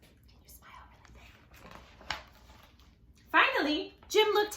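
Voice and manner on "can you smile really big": soft whispered question. Real, not acted. Teacher whispering to viewers, softly.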